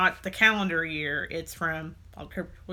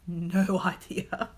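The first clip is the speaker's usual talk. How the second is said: laughing; much slower than usual. Real, not acted. Laughing in disbelief.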